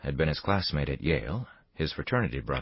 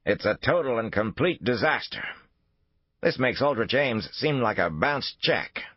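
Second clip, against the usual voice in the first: raised, higher voice, hammering each failure; angry rebuke; 8 semitones higher than usual. Acted. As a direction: lay out the disaster with rising fury. Royce berating Thornhill angrily.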